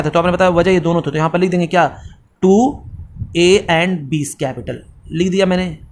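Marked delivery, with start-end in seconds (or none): 2.4-4.7 slow dictation. Shown as a demonstration. Teacher dictates while writing.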